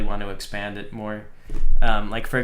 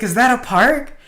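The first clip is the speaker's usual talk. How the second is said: put-on much higher, eager kid question; excited kid; 11 semitones higher, 4 dB louder than usual. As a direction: imitate a thrilled child spotting the park. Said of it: "And he got super excited".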